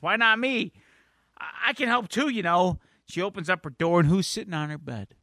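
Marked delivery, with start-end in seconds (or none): 0.0-2.8 high, plaintive acted voice; mock teen grievance; left-out complaint. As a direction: plead to be included, pitched up.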